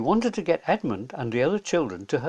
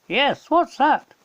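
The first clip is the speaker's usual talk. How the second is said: higher, slow, eager question; curious child leaning in; 11 semitones higher, 7 dB louder, slower than usual. A narrator's reading. Children asking the professor, eager and higher.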